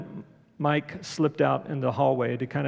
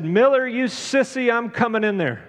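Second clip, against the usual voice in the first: high, loud mock shout through a door; playful bellow at an old friend; retold yell; 8 semitones higher, 5 dB louder than usual. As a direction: call out like a gruff friend barging in. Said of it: "and he yelled out".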